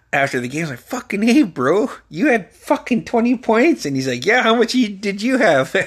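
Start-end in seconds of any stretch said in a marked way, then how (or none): 0.8-3.8 high, incredulous reenacted congratulation; amazed hype. Acting out amazement after the game. 4.2-5.8 teasing comeback. Retold or imitated. Teammate's cocky reply acted out.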